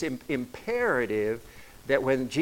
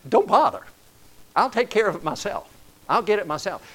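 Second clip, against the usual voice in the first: raised, exasperated snap at a sulky server; huffy and louder, fed up with the server; irritated retort; 8 semitones higher, 6 dB louder than usual. Retold or imitated. Customer snapping back at a rude server, exasperated and loud. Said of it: "you almost want to tell them".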